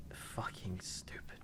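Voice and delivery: slow whispered jab at the king; whispered insult. Real, not acted. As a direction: hiss it slowly under your breath. Insulting the king under breath.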